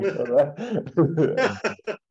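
loud, high burst of laughter; real laugh. Real, not acted. Speaker laughs at own story.

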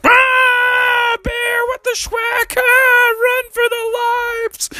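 panicked high-pitched frog shriek; Kermie. Acted. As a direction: squeal the alarm in mock terror. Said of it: "So Kermie went".

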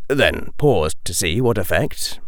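ordinary delivery, nothing marked

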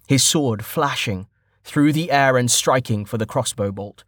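ordinary delivery, nothing marked

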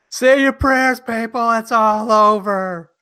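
high, loud mock-panicked proclamation; hammed-up apocalyptic shouting; doomsday yell. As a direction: cry doom to everyone, stretched out. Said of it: "run out of the building yelling".

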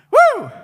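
high ecstatic woo; joyful whoop. Real, not acted. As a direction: let out a preacher's whoop. Preacher's exclamation of joy.

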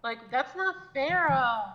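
slow, high, loud whine with a tacked-on "uh"; whiny drawn-out ending. Retold or imitated. Imitating younger people who end words with uh, whiny. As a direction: stretch the last word into a whiny "uh". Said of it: "they end everything with "ugh".

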